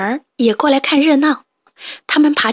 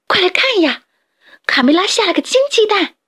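high, eager shout to the others; excited call; 9 semitones higher, 3 dB louder than usual. Acted. Little hen calling the flock over, excited and high. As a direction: call everyone over in delight. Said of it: "兴奋地招呼大家".

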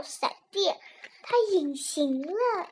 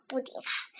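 ordinary delivery, nothing marked

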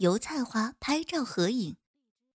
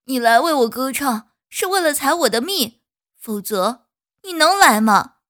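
bitter retort; 2 semitones higher, 9 dB louder than usual. Acted. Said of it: "泡桐花说".

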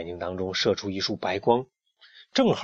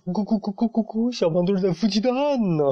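high, clucking singsong taunt; mocking clucks; 10 semitones higher, faster than usual. A narrator's reading. Loudmouth making fun of the brooding hen, mocking. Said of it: "大嗓门在一旁嘲笑着".

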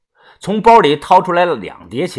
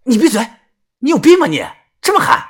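higher, louder hissed scolding; the narrator scolds her for shouting his secret; angry rebuke; 7 semitones higher, 3 dB louder, faster than usual. Acted. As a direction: shut her up fast.

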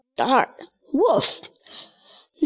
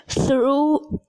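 ordinary delivery

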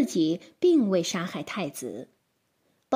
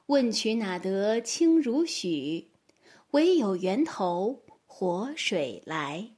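poem recitation; slower than usual. Acted. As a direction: recite slowly and gently.

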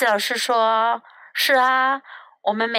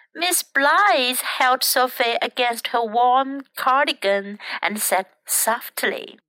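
ordinary delivery, nothing marked

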